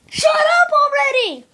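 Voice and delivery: high-pitched play-acted angry yell; angry outburst. Angry bird silencing the others, high angry yell. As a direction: snap it out high and loud. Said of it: "Oh, now I'm angry".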